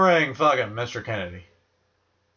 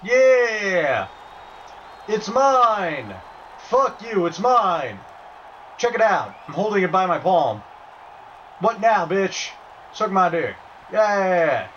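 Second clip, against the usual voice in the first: triumphant gloating; 7 semitones higher, slower than usual. Real, not acted. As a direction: crow over the win like a sore winner. Gloating over won item.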